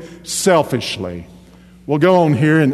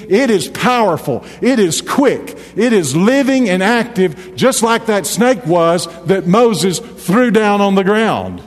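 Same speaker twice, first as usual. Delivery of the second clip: preaching at full force; 4 semitones higher than usual. Acted. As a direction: drive it home to the congregation. Preaching builds to climax.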